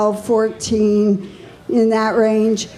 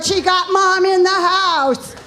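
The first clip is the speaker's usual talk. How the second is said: hype announcement; 8 semitones higher than usual. Retold or imitated. Daughter announcing her mom to the crowd, high and sung. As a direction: belt it like an MC. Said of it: "They go".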